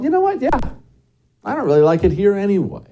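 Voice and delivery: high-pitched acted-out homeowner giving in; imagined shrugging concession. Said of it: "You might be all".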